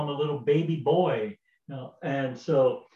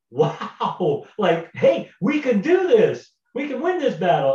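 high, louder rising cheer; excited cheer; 7 semitones higher, 4 dB louder than usual. Retold or imitated. Israelites cheering after the giant falls, high excited shout. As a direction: soar up with sudden hope.

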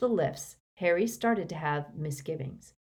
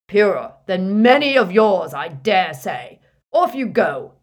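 boomed; 2 semitones higher, 12 dB louder than usual. A narrator's reading. Harry as Runcorn to the wizards and Muggleborns, booming. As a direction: boom it down at them. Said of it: "He boomed".

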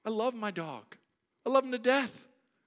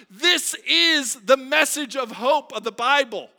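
loud, higher exhortation; preaching at full force; 9 semitones higher, 13 dB louder than usual. Acted. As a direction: command them, urgent and sure. Preaching louder.

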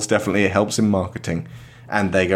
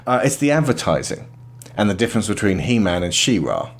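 ordinary delivery

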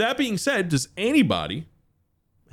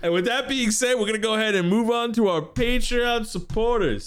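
announcer voice; 12 semitones higher than usual. Acted. Show host outro.

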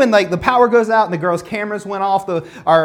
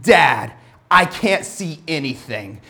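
a hint of a frightened little girl, lower and slow; scared daughter; 5 semitones lower, slower than usual. Daughter calling to dad in the dark, lightly voiced fear. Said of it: "sense the seriousness in her voice".

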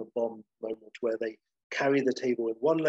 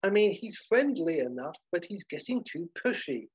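put-on higher-pitched gossiping old lady; 5 semitones higher than usual. Old lady gossiping about the waiter, higher-pitched disapproval. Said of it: "as old lady number one says".

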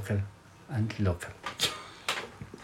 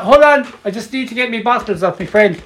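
acted golfer calling a friend; 7 semitones higher than usual. Acts out golfer asking for bottles.

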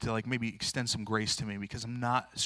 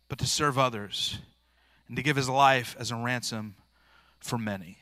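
scripture reading; 5 dB louder than usual. Acted. Reads scripture aloud.

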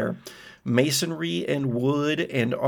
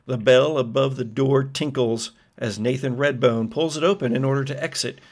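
usual speaking voice

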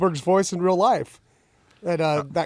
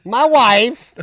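put-on high, loud Borat accent; 5 semitones higher, 7 dB louder than usual.

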